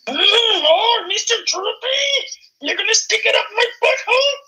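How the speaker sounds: very high falsetto puppet flirt; Miss Piggy. Pretend. Pig flirts with the hound, hammed-up falsetto. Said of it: "have you seen Miss Piggy".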